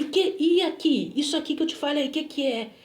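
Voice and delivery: acted impatient interrogation; retold demanding question. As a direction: press for an answer like the stranger. Retold stranger quizzing her.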